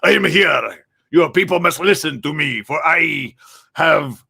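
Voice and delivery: lightly voiced grand proclamation, slow at the end; a hint of a self-important hero; mild mock dramatic decree; pompous heavy accent. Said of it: "Who talks like that?".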